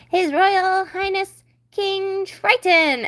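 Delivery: slow, high herald's fanfare cry; herald announcement. Acted. Seahorse herald announcing King Triton, grand and high.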